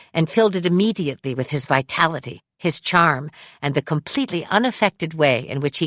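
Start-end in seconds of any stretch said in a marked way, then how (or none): none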